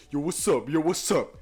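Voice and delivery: loud, hammed-up street greeting.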